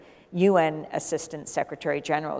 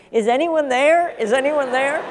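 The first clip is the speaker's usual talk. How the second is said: imitating a faint voice calling over music, higher and louder; distant caller; 8 semitones higher, 7 dB louder than usual. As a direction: call out as if through a noisy phone line. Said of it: "being drowned out by the salsa music".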